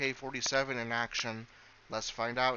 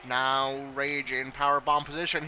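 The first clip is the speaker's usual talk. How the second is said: energetic live wrestling call, a bit louder; play-by-play commentary; 2 semitones higher, 3 dB louder than usual. Acted. Commentator calling the match for viewers, energetic play-by-play. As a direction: call the match as it happens, lifting on big moves.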